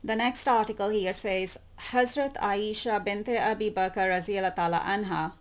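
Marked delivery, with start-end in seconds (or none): none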